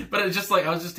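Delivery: breaks into a high laugh. Real, not acted. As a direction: laugh through the words. Laughing at own loss for words.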